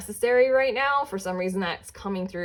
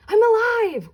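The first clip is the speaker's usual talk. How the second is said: a much higher, louder joyful cry; exclamation point; 12 semitones higher, 5 dB louder than usual. Pretend. Tarot reader voicing the client's zest for life, joyful shout. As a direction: burst out with pure childlike zest. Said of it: "with an exclamation point".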